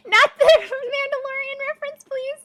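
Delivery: very high, loud squeal of laughter; squeaky laughing plea; laughing protest. Real, not acted. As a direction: plead while cracking up. Cracking up at chat joke.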